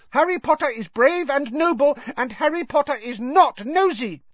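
high, emphatic squeak; indignant little house elf; angry elf. A narrator's reading. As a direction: defend him hotly in a tiny elf voice. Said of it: "said Dobby angrily".

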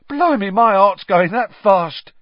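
high, loud, drawn-out gasp of shock; shaken. A narrator's reading. Stan shaken after hearing Voldemort's name, weakly alarmed. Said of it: "said Stan weakly".

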